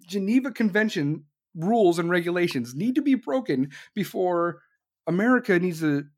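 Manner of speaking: ordinary delivery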